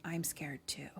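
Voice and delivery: soft, whispered confession; retold whisper. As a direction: whisper it as if hiding. Hugs her while hiding, confides.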